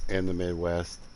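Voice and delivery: ordinary delivery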